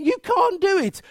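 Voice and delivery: ordinary talk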